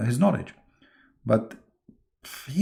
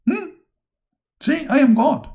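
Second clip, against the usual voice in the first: much higher, louder boasting put-on voice; mock God; 14 semitones higher, 3 dB louder than usual. Mocking God boasting to the angels in a high smug voice. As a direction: gloat like a smug child.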